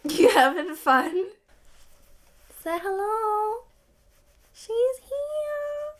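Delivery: high, slow pet-talk voice. Real, not acted. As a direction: coo at the cat. Talking to her cat.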